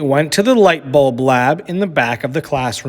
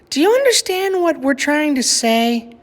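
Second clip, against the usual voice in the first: gentle mother; 12 semitones higher than usual. A narrator's reading. Mother at bedtime gently explaining to Lily. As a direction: let her down gently.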